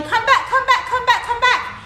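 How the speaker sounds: high, loud, rapid-fire chorus; yelling. A narrator's reading. Chasers call to the gingerbread man, yelling. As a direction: shout it over and over, high. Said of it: "they were yelling".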